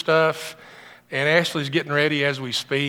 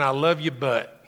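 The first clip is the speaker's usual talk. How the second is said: mock judgmental; 4 semitones lower, 3 dB quieter than usual. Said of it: "critical and judgmental".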